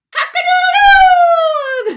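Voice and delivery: very high, loud crowing shout; rooster crow. A narrator's reading. The rooster joining the band, a huge high crow. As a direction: crow at the top of your voice. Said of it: "the rooster said".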